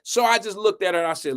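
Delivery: normal talking voice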